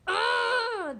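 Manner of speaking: high, exasperated growl; wolf's angry groan. Acted. Wolf furious at being tricked, exasperated groan. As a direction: let out a frustrated groan. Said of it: "This made the wolf so angry".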